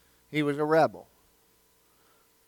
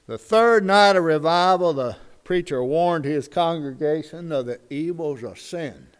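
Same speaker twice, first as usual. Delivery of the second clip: normal talking voice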